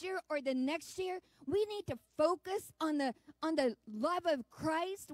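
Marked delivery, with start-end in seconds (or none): none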